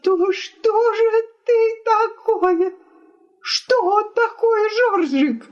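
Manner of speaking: very high, wailing indignation; tearful cry. A narrator's reading. Wife turns to guest against husband, tearful. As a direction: demand it shrilly through acted tears. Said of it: "захлёбываясь от слёз, вскричала жена".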